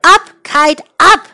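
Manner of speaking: loud, rising call to the kite; shouting. Acted. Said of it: "He shouted".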